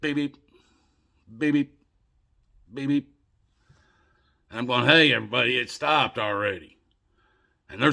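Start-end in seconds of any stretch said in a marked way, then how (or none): 0.0-3.1 high double beeps with long gaps; evenly spaced machine blips; steady monitor beeps. Retold or imitated. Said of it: "even the monitor above my head's going". 4.9-6.8 louder retold call for attention; insistent, raised-voice announcement. Calling out, nobody is listening.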